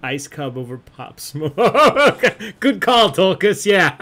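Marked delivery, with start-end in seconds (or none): 1.6-4.0 loud, high words burst out through laughter; real belly laugh running through the words; cracking up. Real, not acted. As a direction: laugh hard while praising the joke. Cracking up at a joke answer.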